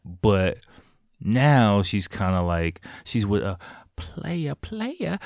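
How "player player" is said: high-pitched comic catchphrase voice; Martin Lawrence. Retold or imitated. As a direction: draw it out high and softer. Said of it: "But Martin Lawrence did".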